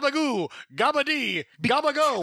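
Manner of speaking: high, sing-out mock Italian calls; high mimicked food shouts; mimicking movie sandwich thrower. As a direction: call out the same deli word, high-pitched. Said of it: "sounds like he's saying the same word".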